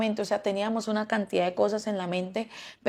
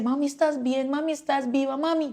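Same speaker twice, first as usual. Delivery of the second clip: higher, anxious mother's voice; a mother's frantic high questions; worried mother; 7 semitones higher than usual. Retold or imitated. Mother on the phone, anxious and higher.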